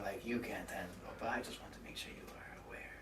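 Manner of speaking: fast, hushed whisper. Acted. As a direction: whisper a warning. Whispered warning about danger.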